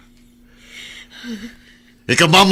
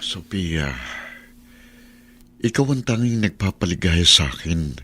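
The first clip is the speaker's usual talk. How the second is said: much lower, softer, intimate and creepy; soft possessive murmur; 8 semitones lower, 6 dB quieter than usual. Acted. Obsessive husband telling his wife she is his joy, soft and possessive. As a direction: croon it close and possessive.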